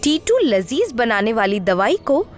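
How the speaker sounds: ordinary delivery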